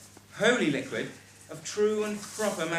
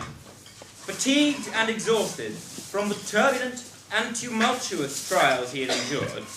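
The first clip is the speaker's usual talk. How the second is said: story narration; 3 semitones higher, slower than usual. Acted. Narrator reading grand script.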